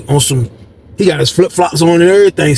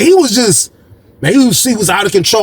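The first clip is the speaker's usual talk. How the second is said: ordinary talk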